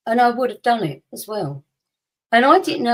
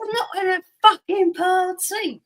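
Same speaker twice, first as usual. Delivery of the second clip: higher, exasperated retort; annoyed mum; 10 semitones higher than usual. Retold or imitated. Mum snaps at her joking husband amid the police, mock exasperated. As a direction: throw it back at him, fed up.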